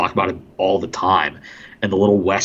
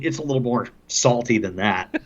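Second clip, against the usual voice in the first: usual speaking voice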